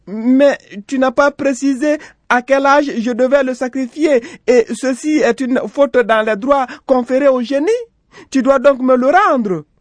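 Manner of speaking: very high, loud protest; high-pitched, forceful mother character. A narrator's reading. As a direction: argue insistently in a high, ringing voice. Mother argues with the genie.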